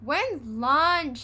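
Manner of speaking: loud high scream. A narrator's reading. Henry screaming for lunch, loud. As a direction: yell it in frustration. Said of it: "screamed Henry".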